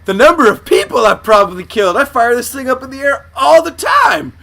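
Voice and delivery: high, loud, hammed-up disbelief; acted horrified realization; mock dismay. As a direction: exclaim like a cop realizing a blunder. Plays cop realizing bullets come down.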